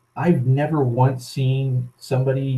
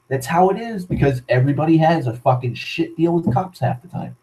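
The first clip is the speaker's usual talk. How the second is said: ordinary delivery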